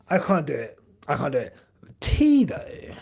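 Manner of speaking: ordinary talk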